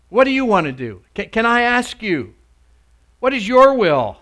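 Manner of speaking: loud, emphatic sermon delivery, pitch swelling on the questions; full-force preaching. Acted. Preacher urging the congregation to ask the Lord, forceful preaching.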